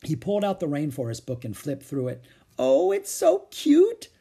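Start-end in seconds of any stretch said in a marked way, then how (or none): 2.6-4.1 very high, loud, slow squeal; gushing. A narrator's reading. Annie adores the spotted baby animal, gushing. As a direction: coo over a baby animal, drawn out.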